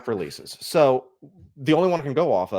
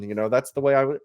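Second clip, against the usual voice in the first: ordinary talk, nothing marked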